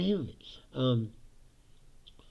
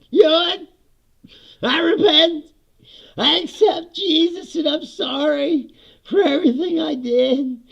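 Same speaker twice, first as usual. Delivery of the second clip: high, loud, pleading mock sinner; mock deathbed repentance; 9 dB louder than usual. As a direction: ham up a killer begging forgiveness, high and loud. Mocking Ted Bundy's deathbed repentance.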